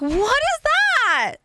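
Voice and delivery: high, drawn-out baffled question; a startled, stretched-out what is that. Retold or imitated. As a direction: act out hearing a strange word, high and slow. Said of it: "I was like".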